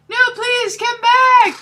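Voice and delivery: high, loud melodramatic begging; hammed-up pleading. Draco begs Ebony to come back, high melodramatic plea. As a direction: overplay the desperate plea.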